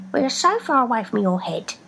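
teasing from far below; foot voice. A narrator's reading.

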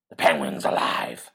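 a growling vigilante defending himself; gruff Batman. Acted. Batman protests to the lawyer in a low gravel growl.

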